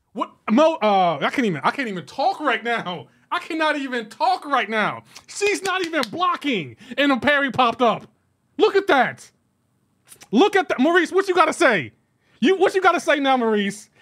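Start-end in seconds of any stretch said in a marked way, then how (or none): 3.3-13.9 incredulous outrage. Real, not acted. Gamer calling out his friend Maurice, high incredulous outrage. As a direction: call out your buddy, stunned and worked up.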